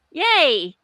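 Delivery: high, loud sarcastic yay; mock cheer. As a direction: cheer in fake delight. Sarcastic cheer at Lords bypass.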